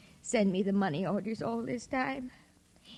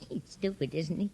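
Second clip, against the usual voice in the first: laughing; 6 semitones lower, 6 dB quieter than usual. Acted. Sophie unhinged, laughing at George.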